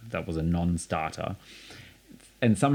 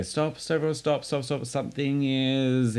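high, sing-out imitation of a director; director halting rehearsal; 6 semitones higher than usual. Musical director to singing cast, imitated halt.

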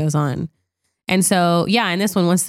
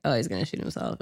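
much softer, low, almost under the breath; hushed realization; 6 semitones lower, 11 dB quieter, faster than usual. Retold or imitated. Viewer predicting the sous chef's gunshot, hushed realization. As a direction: murmur the guess to yourself as the scene unfolds. Retelling own thought watching film.

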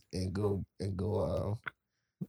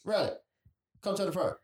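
higher-pitched call to a coworker; 8 semitones higher than usual. Acted. Counter worker summons coworker to the front, calling out. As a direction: call someone up from the back. Manager calls worker from back.

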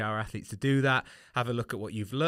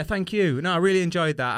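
bright thanks; 6 semitones higher, 6 dB louder than usual. Real, not acted. Warm sign-off thanks.

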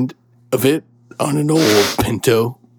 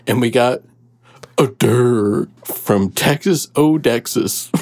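ordinary talk, nothing marked

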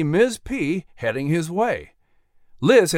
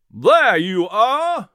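high, drawn-out call; 6 semitones higher, 4 dB louder, much slower than usual. A narrator's reading. Jack finding his runaway mare, raised drawn-out call. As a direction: call it from a little way off.

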